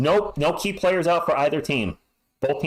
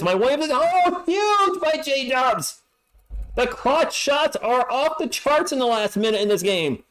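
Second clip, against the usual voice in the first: excited yell; 10 semitones higher, slower than usual. Real, not acted. Streamer calling a clutch shot, excited yell. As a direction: erupt at the clutch three.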